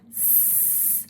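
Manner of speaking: s as a long soft hiss; snake sound. Shown as a demonstration. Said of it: "their snake sound".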